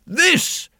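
high, loud indignant king; outraged royal exclamation. Acted. King points angrily at rain.

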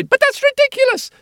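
outraged assistant. Acted.

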